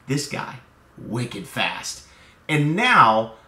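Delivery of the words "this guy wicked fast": hushed, secretive sales tip; confiding whisper. Shown as a demonstration. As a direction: lean in and whisper it like an insider secret. Salesman confiding about fastest widget.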